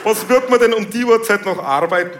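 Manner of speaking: sceptical cop. Acted. Cop challenging the driver.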